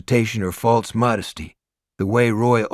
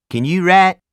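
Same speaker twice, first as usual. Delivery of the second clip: big Southern biker voice; Deep South accent; 9 semitones higher, 8 dB louder than usual. A narrator's reading. Hell's Angel asking Morris, loud Deep South accent. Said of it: "with an unmistakable Deep South accent".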